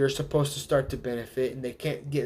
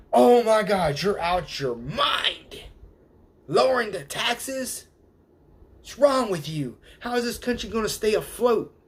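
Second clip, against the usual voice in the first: mock outraged people; 7 semitones higher than usual. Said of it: "people will go".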